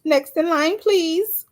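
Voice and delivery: high, sing-song cashier call; clipped snippy cashier. Pretend. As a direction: call the next customer like a cashier. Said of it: "I'll be like a cashier".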